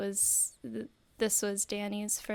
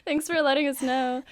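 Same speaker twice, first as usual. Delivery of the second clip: mildly sarcastic thanks, a bit louder; lightly exasperated, sarcastic reply; mock thanks; 4 semitones higher, 9 dB louder than usual. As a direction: brush off a heckler with fake gratitude. Mock reply to the talkative moviegoer.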